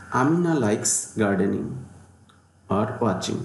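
slow, careful dictation pace; unhurried classroom dictation; slow example reading. Shown as a demonstration. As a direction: read it word by word. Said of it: "teacher reads example sentence".

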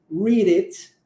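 "read it" run together as ree-dit; linked words. Shown as a demonstration. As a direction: join the d of "read" onto "it". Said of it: "juntamos o read com o it".

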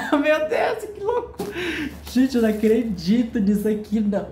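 high laughing squeal of delight; laughing disbelief. Real, not acted. Showing the book to his viewers, high laughing disbelief. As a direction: laugh the words out, unable to believe it.

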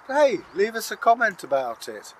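ordinary delivery

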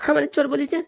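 high-pitched acted sobbing whine; wronged wife crying for sympathy; tearful complaint. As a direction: whimper it out after a bout of sobs. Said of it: "তোমার বৌমা কান্না করছে".

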